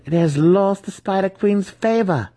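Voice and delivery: high, loud gloating voice; gleeful triumphant cry. A narrator's reading. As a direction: savour the enemy's fall. Matron gloating, evil glee.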